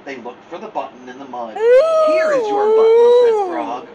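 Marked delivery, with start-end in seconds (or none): 2.1-3.9 very high, very loud cry; loud outburst. Acted. Frog calling to Toad in a very loud high cry. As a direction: blast it out.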